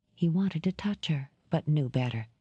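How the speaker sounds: plain reading voice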